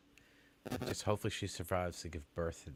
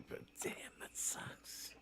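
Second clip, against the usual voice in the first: whispered pity; 12 dB quieter, slower than usual. Real, not acted. Reacting to sad old man.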